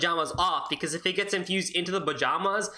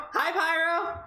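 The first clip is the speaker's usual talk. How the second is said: excited greeting; 11 semitones higher, much slower than usual. Real, not acted.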